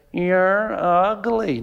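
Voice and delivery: very slow, stretched-out parrot words. Acted. Parrot to the same woman next morning, drawn-out taunt. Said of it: "parrot says".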